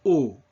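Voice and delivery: O with tight rounded lips, no glide; loud, single closed O vowel; closed pure O. Shown as a demonstration. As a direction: punch out a pure closed French O. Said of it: "very closed and pure".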